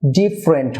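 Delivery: "ডিফারেন্ট" in two syllables, DIF-rent; correct pronunciation. Shown as a demonstration. Teacher models for viewers, "ডিফারেন্ট" as DIF-rent. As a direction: drop the middle vowel of "ডিফারেন্ট". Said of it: "remove হয়ে থাকে".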